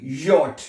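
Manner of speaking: यॉट starting with a y sound; German J as "yot". Shown as a demonstration. Teacher showing the y sound in letter J, "yot". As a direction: say यॉट, no English "jay". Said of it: "ये की आवाज जो है".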